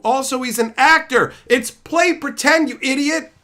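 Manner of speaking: loud, high exasperated shout; exasperated yell. Real, not acted. Scolding fans who hate the actor, loud yell. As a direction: yell it at them in disbelief.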